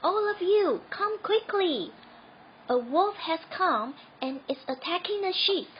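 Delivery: high, loud and urgent; urgent shout. A narrator's reading. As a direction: rouse the whole village. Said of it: "shouted Sam".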